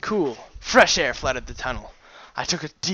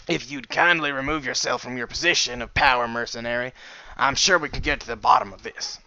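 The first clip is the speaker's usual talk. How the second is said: lightly voiced captain; 4 dB louder than usual.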